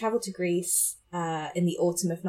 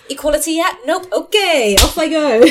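high and louder cheerful voice; perky mock voice; 8 semitones higher, 11 dB louder than usual. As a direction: chirp it like a traveller.

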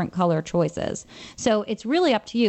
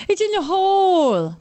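high, slow, lisping Bill Murray; drawn out, slow, high lisp; Bill Murray impression; 11 semitones higher, 4 dB louder, slower than usual. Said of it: "That's my bad Bill Murray impersonation".

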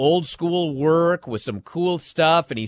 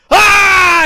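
very loud, high sustained battle cry; hammed-up high roar; fired-up yell; 11 semitones higher, 10 dB louder than usual. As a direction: imitate a wrestler roaring as he fights back. Retold wrestler's yell in corner.

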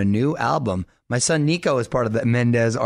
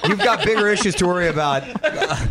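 laughing; 7 semitones higher than usual. Real, not acted. Laughing at Mars mixup.

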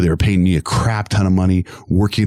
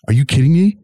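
incredulous; 7 semitones higher, 4 dB louder than usual. Retold or imitated. Friend's incredulous outburst.

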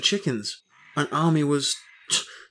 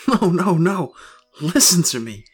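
wild unhinged ranting; 3 semitones higher, 6 dB louder than usual. Acted. Wife calls him crazy.

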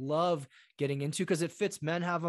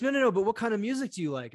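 higher, louder and fast; eager insistent questioning; 6 semitones higher, 5 dB louder, faster than usual. Retold or imitated. Prince urges bride to share her taste, fast and loud. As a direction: press her keenly. Coming to America prince quote.

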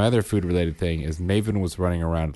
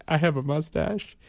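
lightly voiced narwhal; 8 semitones higher, 4 dB quieter than usual. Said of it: "And Bryn's like".

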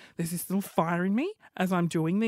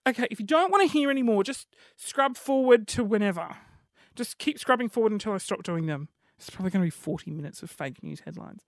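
usual speaking voice

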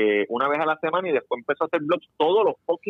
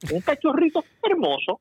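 much higher mock-sweet cooing with a laugh in the middle of "cachorrito"; 12 semitones higher than usual. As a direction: coo "Un cachorrito hermoso" up high, laughing through it. Repeats phrase, second time mocking.

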